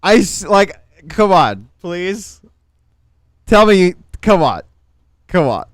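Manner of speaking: loud, high excited begging; pleading. Real, not acted. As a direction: beg chat to find it funny. Pleading with chat to laugh.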